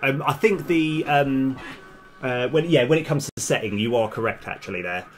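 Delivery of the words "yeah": normal talking voice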